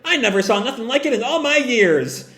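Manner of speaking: high, excited voice of an amazed small deer; excited yelling Chopper. Acted. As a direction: gasp it out in high-pitched wonder. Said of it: "Chopper yells out".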